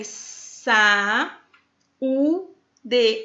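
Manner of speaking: sa, u, d said as separate syllables with pauses; syllables apart. Shown as a demonstration. Teacher splitting saúde into syllables. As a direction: break saúde into sa, u, d. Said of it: "É o caso da palavra".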